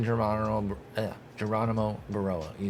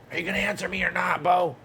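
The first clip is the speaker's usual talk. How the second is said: high, fast, loud demand; high-pitched, fast, loud nagging; shouting at Bo; 9 semitones higher, 5 dB louder than usual. Real, not acted. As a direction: call out to him, impatient. Yelling at unresponsive player.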